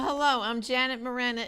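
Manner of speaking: ordinary talk, nothing marked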